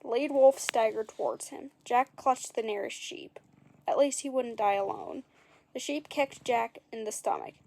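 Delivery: usual speaking voice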